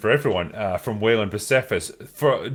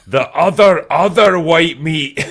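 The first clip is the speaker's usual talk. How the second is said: louder, higher, slow Fat Bastard line; 6 semitones higher, 8 dB louder, slower than usual. Retold or imitated. Podcaster riffing on Fat Bastard, louder and slow. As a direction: ham up the greedy Fat Bastard.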